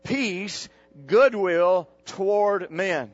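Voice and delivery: preacher leading the verse in unison; slow group recitation. Shown as a demonstration. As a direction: recite it slowly for all to follow. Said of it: "everybody say it with me".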